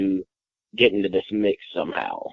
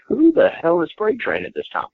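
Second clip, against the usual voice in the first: much higher, incredulous retold outburst; stunned disbelief. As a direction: toss it up high, baffled who this guy is. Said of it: "And I'm like".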